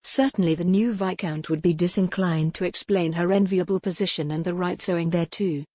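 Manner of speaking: plain reading voice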